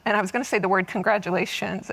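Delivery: ordinary talk, nothing marked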